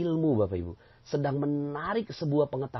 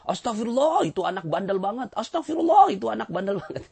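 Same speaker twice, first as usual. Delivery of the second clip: put-on loud, high scolding Astagfirullah, blaming the naughty child; annoyed exclamation; 10 semitones higher, 5 dB louder than usual. Shown as a demonstration. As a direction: shout "Astagfirullah" much higher and louder like an exasperated parent. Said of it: "tapi ngomongnya gini".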